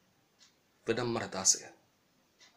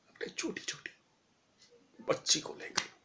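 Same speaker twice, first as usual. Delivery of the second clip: softer, unvoiced whisper; 8 dB quieter than usual. Real, not acted. Pitying walking parents, softly whispered. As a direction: hush it with pity.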